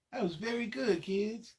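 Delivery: plain reading voice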